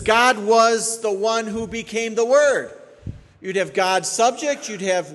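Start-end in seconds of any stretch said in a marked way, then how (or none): 0.0-2.7 "God was the one who became the Word" read slow and loud, higher, as the wrong reading; wrong reading shown. Shown as a demonstration. Preacher shows the congregation a wrong reading, slow and emphatic. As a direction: spell out the heretical reading slowly. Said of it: "it would read like this".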